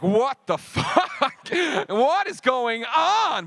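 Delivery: a sudden fright, dragged out slow and high; panicked. Retold or imitated. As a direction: freak out, half laughing. Retelling panic at hallucination.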